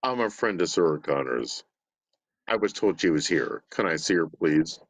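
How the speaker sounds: deep, low robotic monotone; Terminator impression. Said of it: "Do it one more time".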